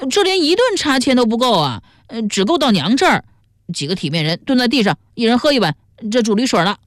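high-pitched whining protest; yelping complaint. A narrator's reading. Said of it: "孙元嚷起来说".

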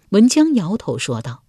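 usual speaking voice

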